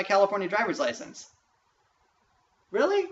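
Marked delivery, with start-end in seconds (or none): 2.7-3.1 high, loud incredulous question; incredulous really. Real, not acted. Ranting about the records error, incredulous question. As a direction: spit out disbelief.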